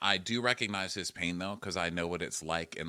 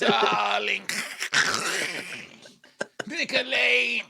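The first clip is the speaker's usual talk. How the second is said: loud, high zombie screech; Lisa Vanderpump; 8 dB louder, much slower than usual. Pretend. Playing a zombie reality star for a laugh, high mock screech. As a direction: shriek it as a hammed-up zombie diva. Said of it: "Can he do Lisa Vanderpump the zombie?".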